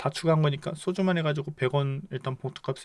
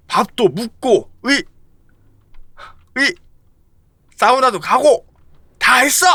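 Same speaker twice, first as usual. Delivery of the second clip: loud, higher fed-up answers; exasperated shout; 9 semitones higher, 12 dB louder than usual. Acted. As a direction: shout back to stop the nagging. Character answering nagging friend. (잔소리에 짜증내며 크게 외치기)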